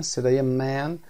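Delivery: ordinary talk, nothing marked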